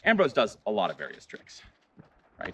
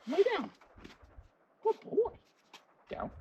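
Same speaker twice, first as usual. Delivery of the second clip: much higher sing-song praise; high dog praise voice. Real, not acted. Owner rewarding her dog, high sing-song praise. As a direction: gush over him in a high voice.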